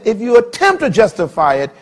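loud, high, driving sermon voice; full-force preaching. Acted. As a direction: hammer each point home to the crowd.

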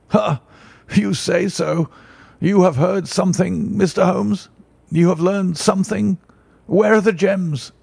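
put-on an elderly banker desperate for news; eager, pleading banker voice; anxious banker. Banker Holder pleads with Holmes.

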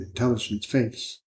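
usual speaking voice

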